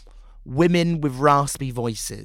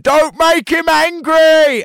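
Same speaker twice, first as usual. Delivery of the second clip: high, loud, slow shout; shouted heckle; 14 semitones higher, 10 dB louder, much slower than usual. Retold or imitated. A passer-by heckles the performer dressed as the witch, a loud, slow, high shout. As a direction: yell it across the street like a heckler. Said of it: "someone shouted".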